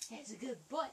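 ordinary delivery

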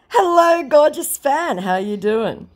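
high, sing-song fake charm; hammed-up fake celebrity greeting; mock scammer voice. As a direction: play the scammer sweet-talking a fan.

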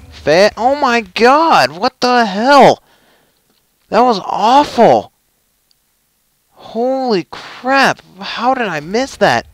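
loud, high, drawn-out disbelief; exasperated outcry. Real, not acted. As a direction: wail in frustration at a terrible miss. Appalled at missing a shot.

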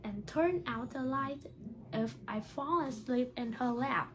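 put-on bright, steady read-aloud voice for children; reading a story aloud. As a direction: read the picture book to the class, a little lifted. Teacher reads picture book aloud.

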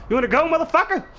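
very high-pitched challenge; battle taunt. Pretend. Gamer challenging an enemy, high-pitched taunt. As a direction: pick a fight in a high yell.

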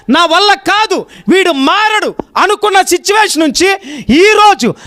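high, loud and slow rejoicing cry; joyful proclamation. Acted. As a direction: shout the son's return for the whole town. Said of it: "గట్టిగా చెప్పాలా?".

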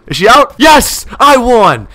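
high, loud, drawn-out victory cry; elated yell of relief; triumphant shout. Real, not acted. Wins the match.

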